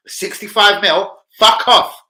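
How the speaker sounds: high, loud mock yell; hammed-up furious dismissal; mock angry shout. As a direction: bark the rejection. Acting out Napoli's threat, climax.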